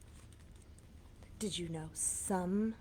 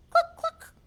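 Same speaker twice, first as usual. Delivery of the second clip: very high, loud hen cluck; 13 dB louder than usual. Acted. Showing kids how a hen clucks, high and loud. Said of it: "than say".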